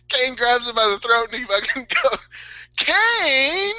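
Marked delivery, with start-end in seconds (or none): 2.8-3.7 higher, louder cry of a name, half laughing; mock yell. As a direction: shout the name as you get grabbed by the throat. Big Show cries out when grabbed.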